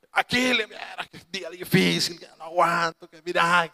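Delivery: hammed-up groaning complaint; mock suffering. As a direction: imitate someone feebly moaning about a hard day. Mimics mother's pretended suffering.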